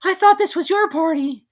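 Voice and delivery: high, timid little voice; squeaky piglet voice; small Piglet. A narrator's reading. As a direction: speak up small and worried.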